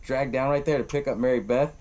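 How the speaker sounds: ordinary delivery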